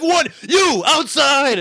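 very high, loud, drawn-out command; slow shout. Retold or imitated. Barista ordering a fighter out, slow yell. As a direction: yell each word at the fighters.

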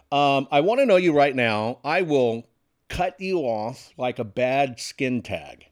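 ordinary talk, nothing marked

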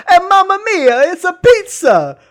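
high, loud cartoon Italian; stretched, booming mock-Italian exclamation; over-the-top Italian accent. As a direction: ham up a sing-out Italian caricature. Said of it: "comically over-the-top Italian accent ever".

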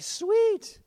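high, loud gleeful voice; gleeful king. Retold or imitated. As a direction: play the eager king thrilled at revenge. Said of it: "So the king's like".

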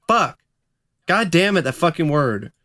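exasperated curse; annoyed outburst; frustrated. Real, not acted. Frustrated at flubbed word.

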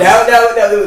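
shouted no, no; loud protest. Real, not acted. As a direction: cut in loudly.

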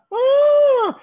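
high excited puppet squeal. Acted. Puppet Gustave squealing on seeing Nancy. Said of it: "c'est Gustave".

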